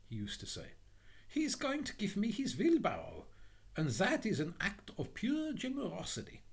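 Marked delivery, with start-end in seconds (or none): 1.3-6.4 high, naive little voice; trusting Little Hans. Acted. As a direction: console yourself with innocent gratitude. Hans consoles himself.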